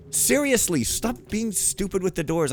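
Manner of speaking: high-pitched, exasperated outburst. Real, not acted. Player scolding game characters stuck at doors, exasperated.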